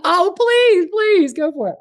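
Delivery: high, bright eager welcome; eager please. Real, not acted. To the interviewer, happily inviting her questions. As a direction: jump at the question, delighted.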